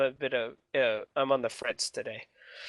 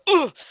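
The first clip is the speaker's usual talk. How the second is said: sharp pained grunt from an elbow; winded grunt; 7 dB louder than usual. Acted. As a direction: grunt like you got elbowed in the ribs. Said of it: "doubles over at the unexpected".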